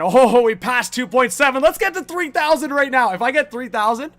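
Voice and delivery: buzzing, high-energy hype; excited. Real, not acted. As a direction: celebrate the milestone out loud. Milestone passed on stream.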